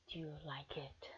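soft teasing whisper; hushed teasing question. Acted. Teasing partner asks quietly.

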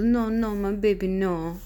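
put-on Russian accent. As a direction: imitate a Russian mother crooning over her baby. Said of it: "she goes".

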